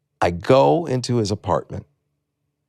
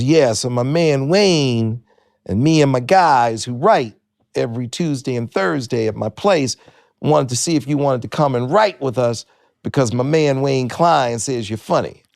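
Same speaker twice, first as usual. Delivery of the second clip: Jimmy J J Walker; 4 semitones higher than usual. Retold or imitated. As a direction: invite grandly, stretching the key words. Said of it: "This is Jimmy J J Walker".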